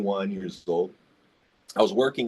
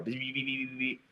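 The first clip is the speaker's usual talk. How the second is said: soft high imitated beeping; ticket printer beeps; 3 semitones higher, 11 dB quieter than usual. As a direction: mimic the printer beeping softly.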